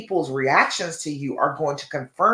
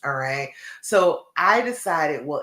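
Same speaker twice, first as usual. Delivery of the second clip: ordinary delivery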